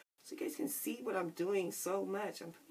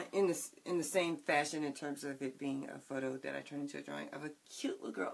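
normal talking voice

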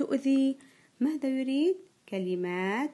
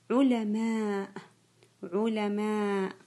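slow dictation; much slower than usual. Shown as a demonstration. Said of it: "سوف أنطق الكلمة".

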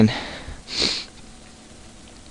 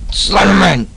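loud high call of the name; dramatic call; 7 semitones higher, 5 dB louder than usual. Acted. Calls out to monster.

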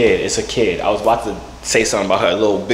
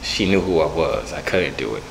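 deflated admission; 9 semitones lower than usual. Real, not acted. Explaining a failed prank after being recognised, low and deflated. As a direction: sink down low and drag it out.

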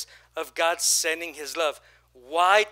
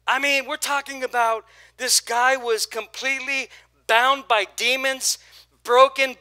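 higher, louder sermon build; preaching at full force; 6 semitones higher, 6 dB louder than usual. Acted. Pastor preaching to the church, building fervor. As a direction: drive it home to the congregation.

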